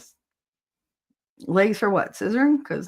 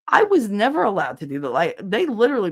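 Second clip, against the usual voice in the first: normal talking voice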